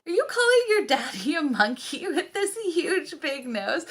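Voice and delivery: high-pitched, laughing teasing question; laughing mock disbelief. Mom teasing son about his monkey joke, laughing disbelief.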